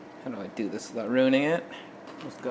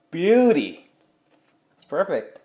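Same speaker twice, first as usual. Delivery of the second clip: delighted; 5 semitones higher, 6 dB louder than usual. Real, not acted. Admiring pizza.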